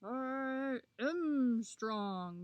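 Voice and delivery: very slow, swaggering animal voice; boastful Mule Deer. A narrator's reading. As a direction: puff your chest out and draw it out. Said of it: "puffing out his chest".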